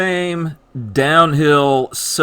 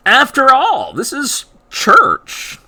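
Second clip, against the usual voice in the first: sarcastic; 8 semitones higher, 3 dB louder, slower than usual. Acted. Sarcastic dig at church attitudes.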